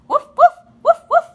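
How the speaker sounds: very high, very loud yapping; excited puppy barks. Acted. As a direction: yap like a puppy hit by a wave. Puppy barks at splashing wave.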